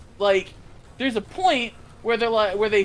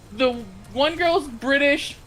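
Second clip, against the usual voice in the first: ordinary talk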